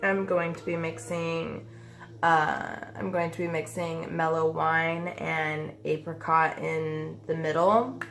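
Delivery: ordinary talk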